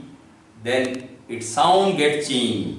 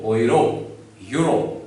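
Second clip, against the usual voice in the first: usual speaking voice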